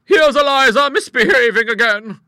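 very loud, high-pitched holler; full-voiced high call; shouting. A narrator's reading. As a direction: shout the news through the house. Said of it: "she shouted".

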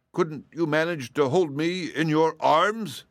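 drawn-out hopeful plea from a horse; cab horse. A narrator's reading. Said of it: "asked the cab horse".

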